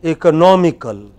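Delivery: loud, clear ecoNOMical for repetition; stress on third syllable. Shown as a demonstration. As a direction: say "economical" punching NOM. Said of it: "I will say it first".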